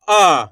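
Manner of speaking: आ said long and forceful, pushed out; stressed long aa. Shown as a demonstration. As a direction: give आ a strong, drawn-out push. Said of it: "इसके उच्चारण में आपको जोर लगाना है". (ज़ोर से खींचा हुआ आ)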